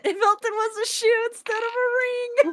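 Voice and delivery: high, excited exclamation. Real, not acted. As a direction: blurt it out delighted. Excited repeat of the joke.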